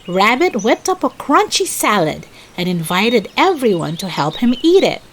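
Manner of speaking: ordinary delivery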